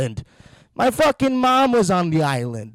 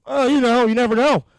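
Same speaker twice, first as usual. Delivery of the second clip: breezy evasive reply; 6 semitones higher, 5 dB louder than usual. Retold or imitated. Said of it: "People would always ask".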